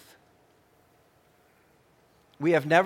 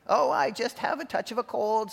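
wolf squeaks higher to pass as granny; squeaky fake granny; 6 semitones higher than usual. A narrator's reading. Wolf explains his odd voice to Red, higher squeaky granny. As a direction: squeak out the excuse. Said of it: "squeaked the wolf".

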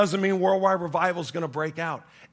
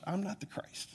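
softer, an inward self-reminder; inner thought; 2 semitones higher, 13 dB quieter than usual. Retold or imitated. Pastor reminding himself each week, soft inward murmur. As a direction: murmur it to yourself as a private realization. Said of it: "where I don't think to myself".

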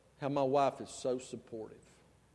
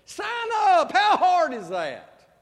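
much higher, louder, slow exasperated cry; exasperated outburst; 14 semitones higher, 12 dB louder, much slower than usual. Acted. Pastor scolding the congregation for not signing up, exasperated. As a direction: throw up your hands at them.

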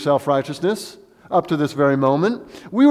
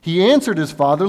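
ordinary talk, nothing marked

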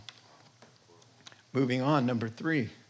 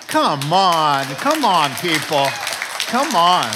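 loud, pleading come on; exasperated appeal; 10 dB louder, slower than usual. Real, not acted. Preacher calling out to his congregation, loud exasperation. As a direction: throw up your hands at the crowd.

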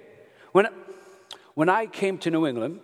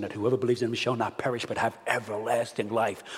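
usual speaking voice

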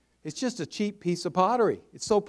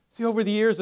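normal talking voice

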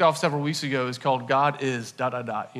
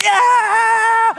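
imitating a crowd's big cheer, much higher and louder; 9 dB louder than usual. Imitating friends cheering him through the spirit tunnel, loud high whoop. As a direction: belt out a mock party cheer. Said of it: "they're all just, like, cheering".

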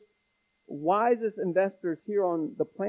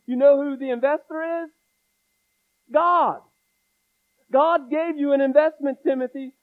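higher, louder preacher's proclamation; full-force preaching; 6 semitones higher, 6 dB louder than usual. Acted. Pastor exhorting his church about salvation, fiery preaching. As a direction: preach it out with fire.

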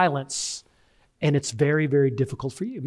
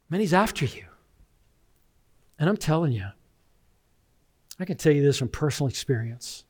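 usual speaking voice

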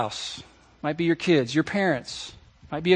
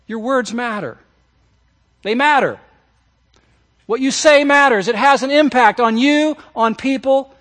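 rising, louder declaration; forceful preaching; 7 semitones higher, 7 dB louder than usual. Acted. Pastor voicing James to the church, preaching louder. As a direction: hammer each phrase home.

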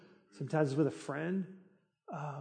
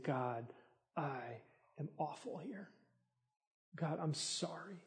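humble confession; 13 dB quieter than usual. Acted.

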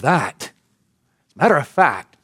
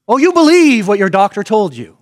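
loud mock-incredulous jab, pitched up; sarcastic; 5 semitones higher, 6 dB louder than usual. Real, not acted. Preacher scoffs at the congregation's claimed belief, sarcastic and loud.

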